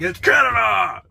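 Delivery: imitating his friend's high, loud indignant shout; mad outburst. Mocking patriotic friend correcting the name, mock outraged shout. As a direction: bellow it back, offended on Canada's behalf. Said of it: "he would always get all mad".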